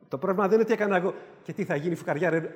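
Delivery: ordinary delivery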